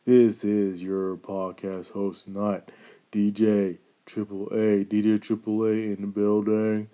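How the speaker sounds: smooth late night radio host. Shown as a demonstration. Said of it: "that's my late night D J voice".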